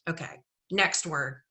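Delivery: normal talking voice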